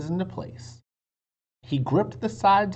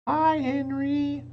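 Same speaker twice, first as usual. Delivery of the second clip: high, slow, louder greeting call; 13 semitones higher, 4 dB louder, slower than usual. A narrator's reading. Annie greets the neighbor's dog far below, high and slow. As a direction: shout down to the dog. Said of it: "shouted Annie".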